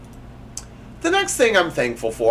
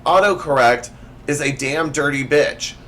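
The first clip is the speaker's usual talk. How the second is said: normal talking voice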